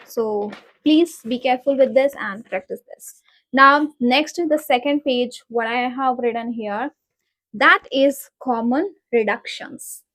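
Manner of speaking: usual speaking voice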